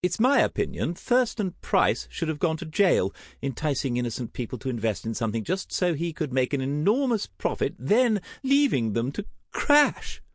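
high, opinionated society lady; theatrical, high-pitched condemnation; indignant aunt. Acted. Voices indignant Aunt Tessa.

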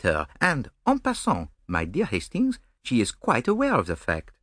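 amused, precise Belgian detective; gently mocking his romantic friend; Poirot.

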